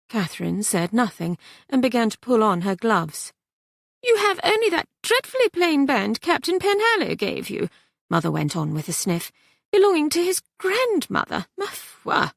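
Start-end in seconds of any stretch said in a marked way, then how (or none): 4.0-12.3 put-on high French-accented sniffing disdain; haughty French mother. Mother sniffing at the daughter's plain ring, haughty high French accent.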